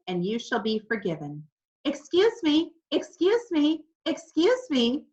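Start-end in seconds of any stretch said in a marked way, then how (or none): 1.8-5.1 high, loud squawking repeats; parrot yelling. A narrator's reading. Parrot yelling down at the pastor mid-sermon, high and loud. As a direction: interrupt the sermon like a shrieking bird. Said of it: "Julius yelled".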